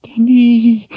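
high gasping cry; high, breathless gasp of a name. Acted. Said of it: "she gasped".

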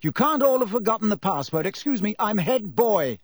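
self-important. A narrator's reading. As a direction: clear the way like you own the place. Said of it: "bustling importantly".

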